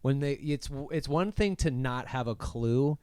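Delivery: ordinary talk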